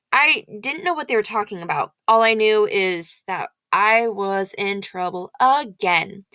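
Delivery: ordinary delivery, nothing marked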